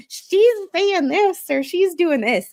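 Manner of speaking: high, slow mocking hater voice. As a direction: parody the haters in a sneering singsong. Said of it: "Facebook hater group going".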